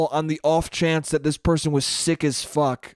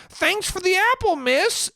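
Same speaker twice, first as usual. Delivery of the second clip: put-on much higher, sweet little-boy thank-you; polite nerdy kid. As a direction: chirp it like a goody-goody kid.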